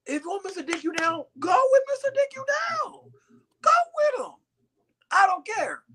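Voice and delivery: high-pitched indignant shout, retold; retold dismissive outburst. Man retelling what he told his cheating ex, indignant shout. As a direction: send the ex off in a high mock yell.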